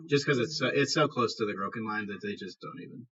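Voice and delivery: ordinary delivery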